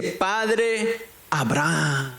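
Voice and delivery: llamado lento y alargado, sin gritar; clamor imitado. Retold or imitated. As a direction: imita un clamor alargando mucho las palabras. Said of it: "dando voces, dice". (clamor fingido, pausado)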